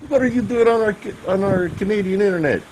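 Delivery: high, bad Canadian accent; crappy Canadian accent. Friend mocking Canadians catching internet thieves, bad Canadian accent. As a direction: squeak it out in a lousy Canadian accent. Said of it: "That's a crappy Canadian accent".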